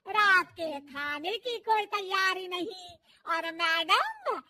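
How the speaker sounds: loud, slow, scolding tirade; mother-in-law ordering daughter-in-law to wash roof; slow angry scolding. Acted. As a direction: drag out each word in anger.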